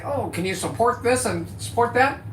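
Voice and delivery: lightly mocked plea for support; mock begging creator. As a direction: parody the begging YouTuber. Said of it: "the guy that's gonna say".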